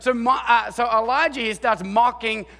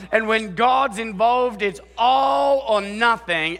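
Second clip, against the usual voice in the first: preached shout; slower than usual. Acted. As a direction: proclaim it slowly to the congregation. Preacher's climax.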